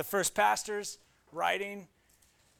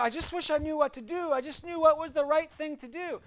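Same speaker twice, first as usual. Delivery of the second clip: higher, wistful imitation of a lost listener; mock wishful churchgoer; 7 semitones higher, 3 dB louder than usual. Pastor mimicking a congregant who wants to know what to do, higher and wistful. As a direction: sigh it out like someone who wants answers. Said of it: "You wanna go".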